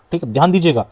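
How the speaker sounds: normal talking voice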